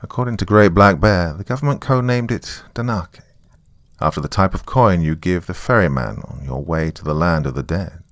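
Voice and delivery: plain reading voice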